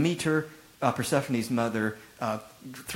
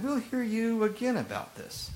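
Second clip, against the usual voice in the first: much higher, light polite reply; curious Athenians; 10 semitones higher than usual. Retold or imitated. Preacher voicing Athenians who want to hear more, mildly curious. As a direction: voice the intrigued listeners. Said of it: "But others said".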